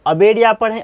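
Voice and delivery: ordinary talk, nothing marked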